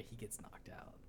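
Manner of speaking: soft teasing whisper; whispered aside. Real, not acted. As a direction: drop to a whisper for the punchline. Teasing aside about the fighter.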